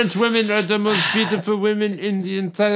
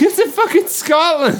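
exasperated retort; 12 semitones higher, 5 dB louder than usual. Retold or imitated. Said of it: "I'm like".

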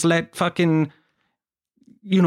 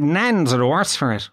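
ordinary talk

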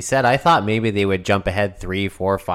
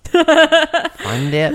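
incredulous laugh; 5 dB louder than usual. Real, not acted. Incredulous at a childish candy.

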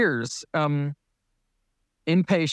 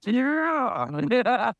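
high, drawn-out rising yeah; a mock delighted yeah; 6 semitones higher than usual. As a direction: sound thrilled at bad news. Reacting to cancer news.

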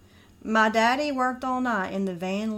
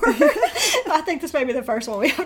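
laughing; 6 semitones higher, 6 dB louder than usual. Real, not acted. Laughing at own singing.

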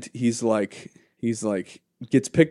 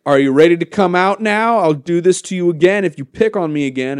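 raised, louder acted boy's threat; mock-tough kid, pitched up; kid taunting bully; 7 semitones higher, 6 dB louder than usual. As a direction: call it out to a locked-up bully. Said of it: "he says, like".

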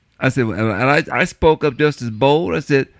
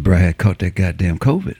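a lower, laid-back buddy voice; buddy's voice; 9 semitones lower than usual. Retold or imitated. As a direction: drop low and easy for the buddy. Retells buddy's phone call.

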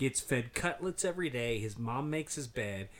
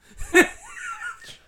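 loud high shouted laugh; sudden loud cackle; 12 dB louder than usual. Real, not acted. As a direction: let out a big whooping laugh. Laughing hard at cult joke.

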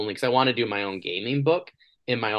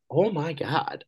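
exasperated; 8 semitones higher, slower than usual. Retold or imitated. Retold exasperated reaction.

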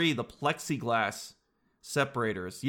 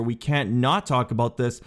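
usual speaking voice

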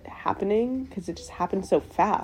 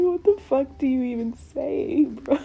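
disbelieving; 6 semitones higher than usual. Retold or imitated.

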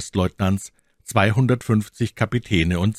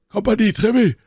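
Athos answering; 5 dB louder, faster than usual. A narrator's reading.

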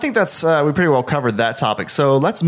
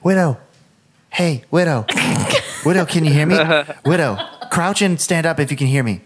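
imitating pestering kid; 2 semitones higher than usual. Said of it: "he kept being like".

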